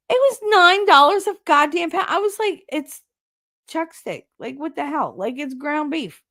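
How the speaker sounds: high-pitched outraged rant. Real, not acted. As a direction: squeal about the price. Ranting about meat prices.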